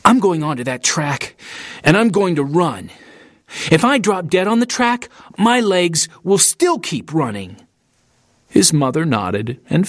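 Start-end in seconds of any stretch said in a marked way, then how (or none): 0.0-7.6 climbing, higher-pitched determined outburst; mildly heated, insistent declaration; defiant blurt. A narrator's reading. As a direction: blurt it out as a vow, pitch rising. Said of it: "turned to his mother and blurted".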